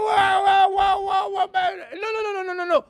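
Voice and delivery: high, scornful brush-off, voice pushed up; mock dismissive voice. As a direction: act out a careless listener waving it away. Mock reply of careless person. (voix moqueuse et dédaigneuse, très aiguë)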